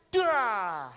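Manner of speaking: loud, high vocal bang; sudden explosive crash noise; imitated door slam. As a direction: cry out the slam sound.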